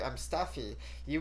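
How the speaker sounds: ordinary talk, nothing marked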